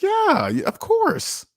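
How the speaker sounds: high, sugary mock boss; fake reassurance. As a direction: reassure him falsely, way up high.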